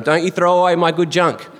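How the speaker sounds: high-pitched protesting call; calling from another room. Retold or imitated. Dad calling to mum from the other room, protesting. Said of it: "you heard the voice from the, from the other room".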